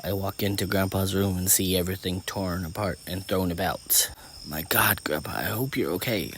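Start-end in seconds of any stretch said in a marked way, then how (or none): 4.4-6.3 worried words under the breath; worried self-talk. A narrator's reading. Girl seeing grandpa's room torn apart, worried self-talk. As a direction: murmur anxiously to yourself. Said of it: "I say to myself".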